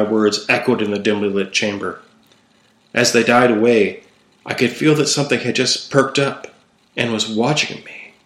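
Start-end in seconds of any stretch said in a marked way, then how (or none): none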